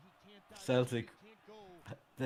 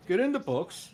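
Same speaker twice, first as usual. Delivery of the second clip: higher, louder put-on exclamation; playful raised-voice impersonation; talking like Joey; 7 semitones higher, 5 dB louder than usual. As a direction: lightly imitate Joey, pitched up and loud. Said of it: "if he talked like Joey".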